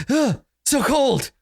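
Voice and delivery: high, drawn-out shiver of complaint; slow, high-pitched put-on voice; recoiling from cold. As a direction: gasp and whine at the cold touch. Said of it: "he touches his shoulder and he's like".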